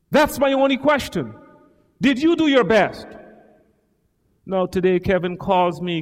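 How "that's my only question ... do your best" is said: higher and louder, pressing the question; emphatic preaching. Real, not acted. Preacher to congregation, pressing loud and high. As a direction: hammer the question home.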